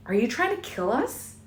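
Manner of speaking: high, tight frightened demand. A narrator's reading. Bella demanding answers from reckless Edward, high and tight. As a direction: demand it through fear. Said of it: "her voice high and tight".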